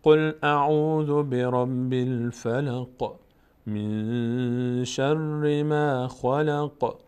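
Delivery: full melodic tajweed chant; slow Quran recitation. Shown as a demonstration. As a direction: recite the sura together slowly.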